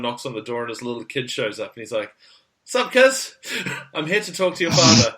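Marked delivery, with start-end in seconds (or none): 2.6-5.1 put-on broad Kiwi accent, higher pitched; broad Kiwi bloke. Obi-Wan's Kiwi visitor greets a kid at the door, broad Kiwi. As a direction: play up the thick Kiwi greeting. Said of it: "real hoary Kiwi".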